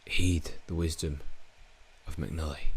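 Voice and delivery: a hint of a mystic elder; mock grand voice. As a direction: intone it like a mock sage.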